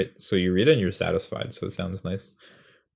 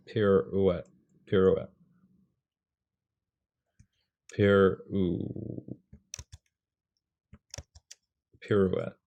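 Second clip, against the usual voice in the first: pirouette, tried twice then broken at pirou-; sounding out pirouette; much slower than usual. Shown as a demonstration. Teacher sounding out pirouette for rhythm. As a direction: test pirouette syllable by syllable. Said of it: "I'm trying to sound it out".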